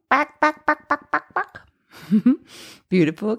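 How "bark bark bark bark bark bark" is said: high, staccato chicken clucks; chicken squawk. Shown as a demonstration. Singing teacher demonstrating twang to students, chicken squawk. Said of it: "Can you give me, like, a chicken?".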